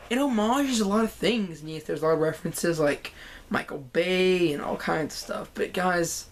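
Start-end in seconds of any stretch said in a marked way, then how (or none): none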